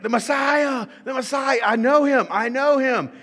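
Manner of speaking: high, loud excited shout; ecstatic crowd. Retold or imitated. Crowd greeting the Messiah like Buddy, high ecstatic shout. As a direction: shout it like Buddy meeting Santa. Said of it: "That's kinda what's going on here".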